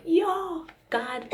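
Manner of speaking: high-pitched, loud "yo" of amazement; retold cry of happy shock; astonished exclamation. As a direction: cry out high in mock disbelief. Retold amazed cry on good news.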